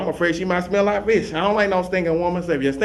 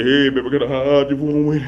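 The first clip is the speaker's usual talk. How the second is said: a hint of a smooth player; smooth pickup line. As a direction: croon it to the lady like a player.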